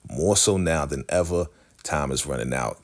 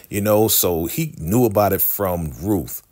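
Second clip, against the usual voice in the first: ordinary talk, nothing marked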